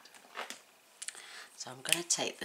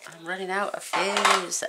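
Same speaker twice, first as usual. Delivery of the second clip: high slow laughing exclaim; 5 semitones higher, 8 dB louder than usual. Real, not acted. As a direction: blurt it out laughing.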